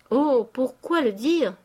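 loud, high, drawn-out exclamation; crying out. A narrator's reading. Rastignac protesting to the old father, crying out. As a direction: cry out in protest, stretching the words. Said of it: "s'écria Rastignac".

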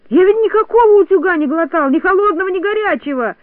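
high, loud, outraged denial; shouting in protest. A narrator's reading. Toropyzhka insists he swallowed nothing, shouting. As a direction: protest hotly, voice pitched right up. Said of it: "кричал Торопышка".

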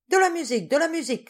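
loud, high call for music; shouted. A narrator's reading. Tipsy man shouting to the bar for music, loud. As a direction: shout it across the café. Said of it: "cria-t-il".

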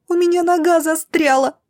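high, frightened cry for help; a girl's panicked squeal; the curly girl cries out her foot is caught. A narrator's reading. Girl stuck, platform cracking.

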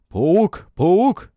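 high, loud terrified shriek. A narrator's reading. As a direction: shriek it in panic. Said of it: "истошно визжит он".

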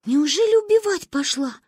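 put-on high, scared child voice; frightened. A narrator's reading. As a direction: gasp it out in fear. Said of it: "испугалась Маня". (испуганно)

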